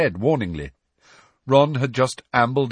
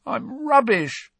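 high, slow, croaked lament; croaking; slower than usual. A narrator's reading. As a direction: croak it out miserably. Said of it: "croaked Ron".

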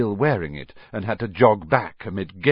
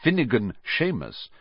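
ordinary delivery

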